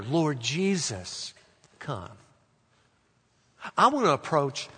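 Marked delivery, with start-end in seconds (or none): 0.0-2.1 slow, softening plea; hushed, unhurried petition; prayer. Shown as a demonstration. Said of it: "or it's a prayer".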